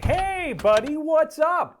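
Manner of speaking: hammed-up, high, loud American greeting; big stretched-out, over-friendly hello; affectionate greeting. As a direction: greet a long-lost pal like an excited dog owner. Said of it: "can be used in an affectionate way".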